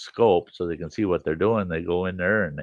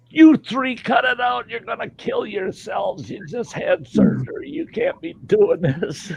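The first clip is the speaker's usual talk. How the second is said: scolding nurse. Retold or imitated. Charge nurse scolding three patients in a pillow fight, high and stern.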